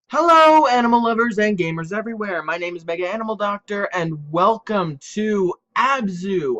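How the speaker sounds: bright, loud channel intro; YouTube intro. Acted. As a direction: greet the viewers with big energy.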